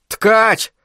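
high, urgent hail without full volume; calling out. A narrator's reading. Isaac claps before the spider, calling out sharply. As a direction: call to get his attention.

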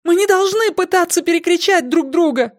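very high, frantic yell; panicked high-pitched shouting. A narrator's reading. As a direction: shriek over everyone else. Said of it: "заорала Силья".

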